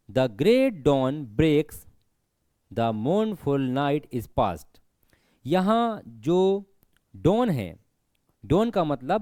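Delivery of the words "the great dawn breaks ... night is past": each word drawn out, very slow; slow poem recitation. Shown as a demonstration. Teacher reciting a poem line to students, very slow. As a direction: read the line out slowly for the class.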